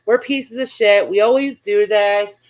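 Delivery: ordinary delivery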